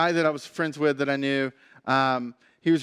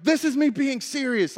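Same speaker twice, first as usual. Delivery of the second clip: higher-pitched, indignant mock insistence; angry insistence; 8 semitones higher than usual. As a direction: insist hotly that you mean it. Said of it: "getting really angry and really frustrated".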